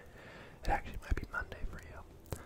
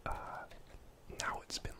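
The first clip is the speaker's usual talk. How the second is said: ordinary talk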